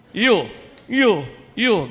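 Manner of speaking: loud, high, imitated grouchy summons; grumpy monsignor. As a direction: call out sharply like a crabby old priest. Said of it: "Ganun magsalita". (masungit na monsignor, malakas at mataas)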